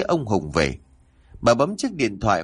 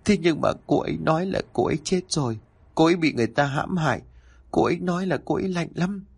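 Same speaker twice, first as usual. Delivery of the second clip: fast, higher tearful words; crying while speaking; 6 semitones higher, faster than usual. A narrator's reading. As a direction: speak through sobs. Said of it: "Vừa khóc anh vừa nói".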